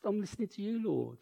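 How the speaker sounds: very soft, unforced voice; relaxed. Acted. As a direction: drop right down into a hushed, easy prayer. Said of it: "It's just relaxed".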